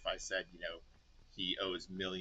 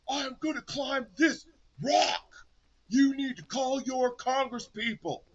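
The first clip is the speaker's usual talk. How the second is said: loud, slow wrestler promo voice; booming wrestler boasts; 12 dB louder, slower than usual. Pretend. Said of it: "wrestler voice".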